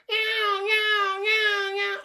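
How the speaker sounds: high, loud meowing cat; squealing kitty meows. Retold or imitated. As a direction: meow like a cat stuck up a tree. Cat stuck in tree imitated.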